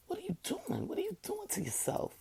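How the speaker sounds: pleading with herself in a high, hushed voice; asking herself. Retold or imitated. Talking to herself about overwork, high and soft. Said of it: "I had to say".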